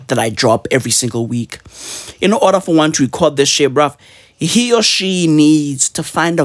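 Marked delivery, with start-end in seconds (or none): none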